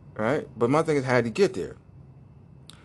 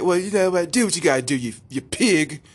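defiant mock-English kid; put-on English accent, raised pitch; 5 semitones higher, 3 dB louder than usual. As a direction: taunt in a cheeky English accent. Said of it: "I wanna give him an English accent".

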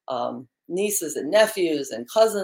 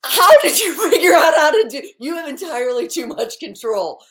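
high, loud words through laughter; laughing as the co-host takes over her controls; 10 semitones higher, 8 dB louder than usual. Real, not acted. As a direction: blurt it out while cracking up.

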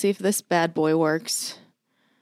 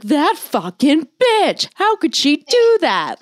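higher, drawn-out mock outrage; retold outrage; 8 semitones higher, slower than usual. Friend recounting her fury at being pranked, indignant. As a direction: act out fuming indignation, stretching the words. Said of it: "I was so just not having it".

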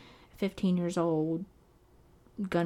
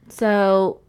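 normal talking voice

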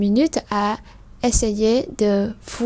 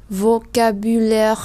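slow demo word; much slower than usual. Shown as a demonstration. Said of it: "các bạn có thể lặp lại các cái từ đó".

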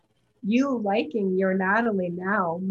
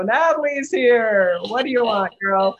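mock welcoming world; 2 semitones higher, 6 dB louder than usual. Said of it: "the whole world was gonna go".

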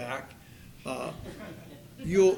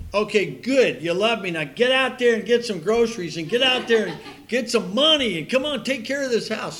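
louder, higher, pushy wife with a laugh; hammed-up wife ordering her husband to go earn money; exasperated wife; 6 semitones higher, 6 dB louder than usual. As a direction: shoo him out the door, half laughing. Wife finally orders him out.

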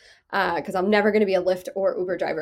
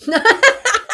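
high squealing laugh; 14 semitones higher, 8 dB louder than usual. Real, not acted. Reacts to pitch of show.

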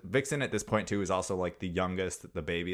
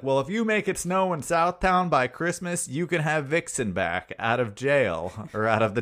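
southern mayor; 6 semitones higher, 5 dB louder than usual. Retold or imitated. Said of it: "southern mayor".

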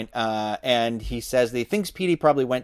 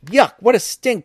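high, louder outburst of disgust; disgusted exclamation; 9 semitones higher, 5 dB louder than usual. A narrator's reading. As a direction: exclaim it with revulsion, pitch jumping up. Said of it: "Josh exclaimed".

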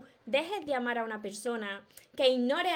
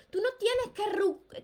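higher and slow; still fired up; 8 semitones higher, slower than usual. Real, not acted. Coach insisting to viewer she needn't recover him, higher pitch. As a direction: keep the heat as you start again.

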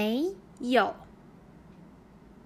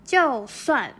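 就算 said louder, set apart on its own; clear model word; 2 semitones higher, 7 dB louder than usual. Shown as a demonstration. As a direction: say 就算 firmly and alone. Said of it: "は、たとえの意味です". (就算をはっきり強めに)

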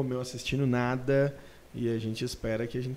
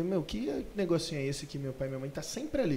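higher-pitched, lightly voiced wondering child; curious child; 6 semitones higher than usual. Child watching parents glued to their phones, lightly imitated curious kid. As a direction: voice a kid's envious curiosity, lightly. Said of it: "E aí a criança vê".

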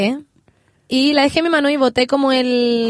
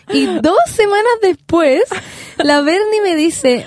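dramatic slow build-up; 6 semitones higher, much slower than usual. Acted. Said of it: "dramatic build-up".

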